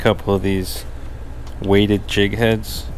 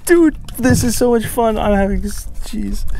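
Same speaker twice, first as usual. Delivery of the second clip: much higher, giddy squeals with a laugh; thrilled; 12 semitones higher than usual. Real, not acted. Angler hooking a snapper, squealing and laughing with joy. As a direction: burst out laughing as the fish fights.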